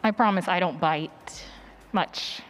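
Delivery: ordinary delivery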